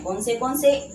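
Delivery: usual speaking voice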